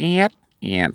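soft, high windscreen-wiper squeak; imitated wiper squeak. Shown as a demonstration. Imitating wipers at level one.

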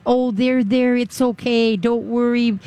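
high cooing to a dog; mock coddling. Owner coddling a nervous dog, high cooing. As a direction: coo over the dog like soothing a baby. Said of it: "coddle a dog that is stressed".

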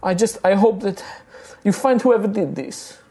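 high, gentle halfling voice reassuring; high consoling woman. Acted. Grieving halfling comforting the guilty knight, high and gentle.

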